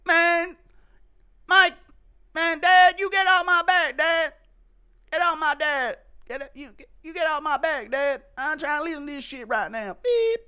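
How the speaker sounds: high, loud whining protest at dad; whiny teenage son. Acted. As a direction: screech it like a bratty kid. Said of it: "Chandler scolding his son".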